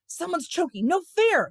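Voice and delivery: high-pitched outcry; frantic protest. A narrator's reading. Boy objects mid-fight, high-pitched frantic cry. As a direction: yelp it from the middle of a brawl. Shouts in a brawl.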